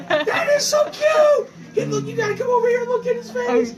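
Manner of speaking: loud, very high squeal of delight; high, excited cooing at a tiny creature; gushing squeal. Real, not acted. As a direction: gush breathlessly over something adorable. Gushing over a cute animal.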